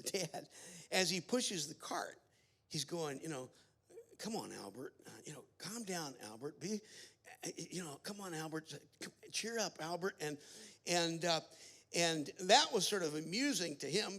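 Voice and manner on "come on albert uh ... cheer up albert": put-on soft, coaxing murmur to a fussing child; quietly talking someone down; soothing dad. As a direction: play the patient father, hushed and soothing. Voices dad soothing fussy son.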